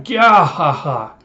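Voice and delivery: loud, slow mock evil laugh; hammed-up pirate guffaw, drawn out; villain cackle. Slaver gloating over a fleeing woman, cackling.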